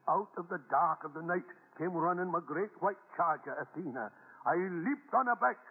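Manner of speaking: high, rousing storyteller's voice building the adventure; dramatic tall-tale telling. Acted. Grandpa thrilling his grandson with a tall tale, high and rousing. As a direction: sell the war story like a hero.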